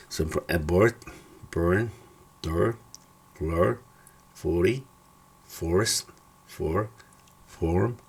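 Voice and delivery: "door", "floor", "horse" with an English OR; English OR words. Shown as a demonstration. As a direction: model "north", "short", "pork" carefully. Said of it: "se pronuncia como en el español, O".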